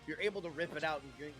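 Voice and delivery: ordinary talk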